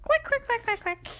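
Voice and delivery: put-on high, playful duck quacking for a toddler. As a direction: squeak out the quacks like a cartoon duck. Parent voicing duck for toddler.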